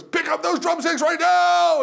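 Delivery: high, slow, full-voiced announcer shout; Mark Stelzner. Announcer ordering the crowd to grab drumsticks, huge shout. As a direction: bellow a command to the whole hall. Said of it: "gorgeous baritone voice".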